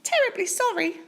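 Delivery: high small apologetic mouse; mouse murmur. Acted. Mouse murmurs apology to Bear, high squeak. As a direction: say sorry sweetly. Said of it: "murmured the mouse".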